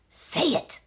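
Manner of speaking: breathless whispered prompt; shepherd prompting on stage; whispered urging. Acted. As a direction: urge her in a whisper. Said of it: "whispered one of the shepherds".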